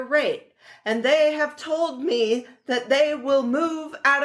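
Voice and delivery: put-on high, slow transatlantic accent; slow, lofty transatlantic accent of an old president; FDR transatlantic accent. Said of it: "I was trying to do my transatlantic accent".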